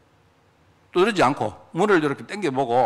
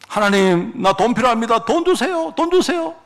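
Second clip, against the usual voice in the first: nagging prayer climbing ever higher in pitch; mock pleading; 5 semitones higher than usual. Preacher mimics a believer begging God for money, mock pleading. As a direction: imitate someone pestering God, pitched right up.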